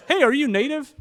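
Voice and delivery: put-on a second, bigger go at the Canadian accent. Canadian guy at the bar asking if he's native, in a hammed-up Canadian accent. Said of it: "this is a bad accent".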